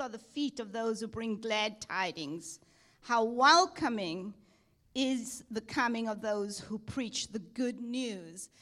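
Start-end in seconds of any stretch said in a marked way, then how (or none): none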